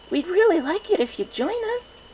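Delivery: very high, loud eager yelp; a tiny unicorn blurting out her wish. A narrator's reading. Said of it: "the unicorn yelped".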